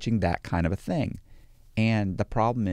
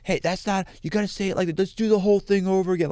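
high-pitched, hammed-up tantrum from a fussy author; mock flip-out; 10 semitones higher than usual. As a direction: throw a mock fit like a diva on set, much higher. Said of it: "You might suddenly flip out".

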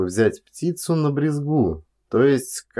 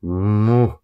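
woken grunt, louder; groggy half-awake grunts; man roused from sleep, grumbling; sleepy mumble; 3 dB louder, much slower than usual. A narrator's reading. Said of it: "мычит с просонья".

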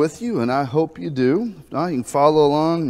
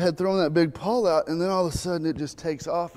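normal talking voice

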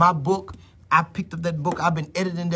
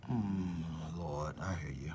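much softer, lower inward murmur; hushed murmur to God; 12 semitones lower, 14 dB quieter, slower than usual. Real, not acted. Preacher murmuring to God while on live. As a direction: talk quietly to the Lord as if listening. Listening to God mid-sermon.

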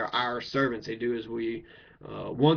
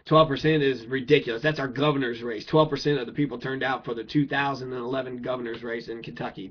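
ordinary delivery

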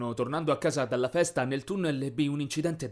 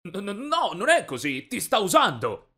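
stammered, raised-pitch denial; panicked protest; 6 semitones higher, 4 dB louder than usual. A narrator's reading. Accused boy warning the boyfriend she lies, flustered protest. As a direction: protest urgently, louder by the end.

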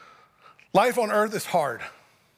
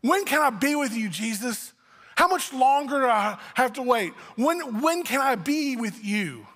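higher, drawn-out impatient pleading; groaning with longing; 4 semitones higher, slower than usual. Acted. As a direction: plead like a child tired of waiting. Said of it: "Paul groaning to be with Jesus".

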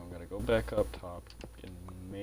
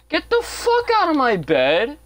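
high screaming yell at the villager; furious yell; 10 dB louder than usual. Real, not acted. Gamer screaming at a villager in his bed, furious yell.

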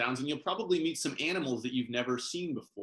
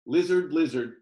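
calling out; 4 semitones higher, 9 dB louder than usual. Acted. Calling to the lizard.

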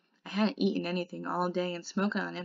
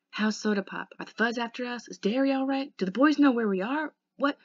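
rising, eager rush of questions; excited questions; 5 semitones higher, 5 dB louder than usual. Acted. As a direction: pepper him with questions, excited. Eager questions after isolation.

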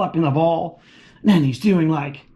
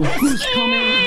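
high, slow, shouted question; excited shout; slower than usual. Acted. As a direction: call it out big and slow. Building suspense for the kids.

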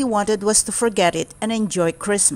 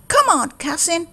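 higher, eager calling; eager cry; 9 semitones higher than usual. Acted. Town Mouse urging his cousin into the car, eager cry. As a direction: urge him on, excited. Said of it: "he cried".

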